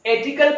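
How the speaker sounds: "एटिकल" with the letter-name A as first vowel; mispronunciation. Shown as a demonstration. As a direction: say "एटिकल" opening on "ay", no r. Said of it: "नहीं होगा".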